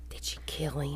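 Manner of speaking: very soft whispered question; gasp, then whisper it; hushed, suspenseful whisper. Real, not acted. Hushed reaction to tense story.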